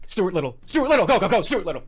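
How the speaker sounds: loud, high, urgent calling; frantic claim. Acted. Racing to claim the movie first, frantic. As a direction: shout it out in a mock rush.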